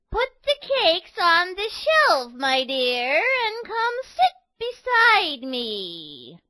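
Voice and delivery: high, slow sweet fake granny voice; wolf as granny. A narrator's reading.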